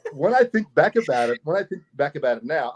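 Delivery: normal talking voice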